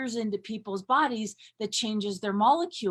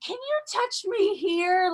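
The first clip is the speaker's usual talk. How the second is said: higher, lightly voiced mother asking; mother asking her little girl for a touch, higher; 10 semitones higher than usual. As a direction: ask it up high like mom wanting help. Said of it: "and be like".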